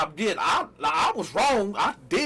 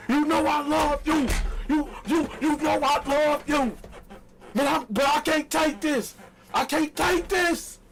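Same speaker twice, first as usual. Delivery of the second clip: mock heartbroken outrage; 7 semitones higher than usual. To his girlfriend, a hammed-up heartbroken tirade. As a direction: ham up the hurt boyfriend. Fake dramatic exit speech.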